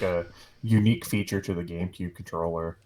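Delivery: ordinary talk, nothing marked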